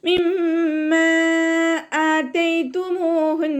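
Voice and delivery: slow, high, drawn-out Quranic chant; measured tajweed recitation. Shown as a demonstration. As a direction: recite it in tajweed, stretching each sound. Recites verse between explanations.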